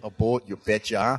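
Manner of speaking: light put-on Minnesota accent, long rounded o in boat; a playful stab at a Minnesota accent; hint of a Minnesota accent, teasing the boat going by. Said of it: "I'm trying to sound like I'm from Minnesota".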